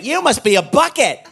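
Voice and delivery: hammed-up clueless guy; dumb cashier. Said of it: "and he went".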